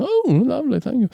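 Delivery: put-on high, fussy old man voice. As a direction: chatter it like a fussy old craftsman. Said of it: "this old guy".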